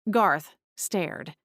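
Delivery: plain reading voice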